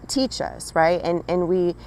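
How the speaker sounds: ordinary delivery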